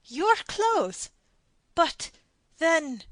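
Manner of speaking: a voice reeling with disbelief. A narrator's reading. Stunned don questions his captor, aghast. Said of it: "still more aghast he added".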